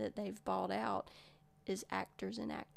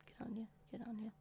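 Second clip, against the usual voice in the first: whispered Australian accent; 9 dB quieter, much faster than usual. Shown as a demonstration. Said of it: "All I can say is".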